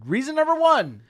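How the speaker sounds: high, loud proclamation; announcing loudly. Acted. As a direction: declare it like a verdict. Mock announcing reasons for firing.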